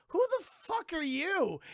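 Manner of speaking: high, slow, drawn-out question; hammed-up pirate disbelief; incredulous challenge. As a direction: stretch it out in mock bewilderment. Barbossa acted challenging Will.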